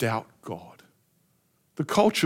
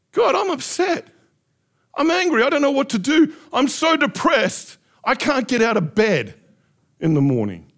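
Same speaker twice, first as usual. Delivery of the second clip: much higher, louder cry of pain; desperate honest prayer, high and loud; raw honest prayer; 6 semitones higher, 3 dB louder than usual. Shown as a demonstration. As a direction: pour out despair to God. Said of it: "Why do we use a different even language".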